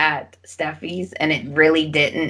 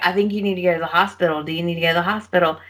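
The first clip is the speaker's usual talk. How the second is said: rushed worry; much faster than usual. Retold or imitated. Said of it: "'Cause he's like".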